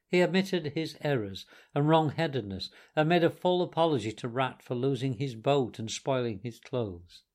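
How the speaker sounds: ordinary delivery, nothing marked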